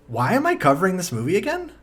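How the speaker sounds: usual speaking voice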